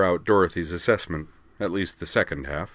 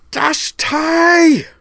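full-throated scream, far higher and louder; furious scream; 13 dB louder than usual. A narrator's reading. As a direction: scream it as you slam the door. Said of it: "screaming as she did so".

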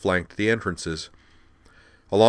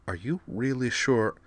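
softer, higher nervous question; hesitant; 4 semitones higher, 4 dB quieter than usual. Acted. Devlin doubting before entering the hall, nervous and soft. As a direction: doubt it one last time. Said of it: "hesitated once more".

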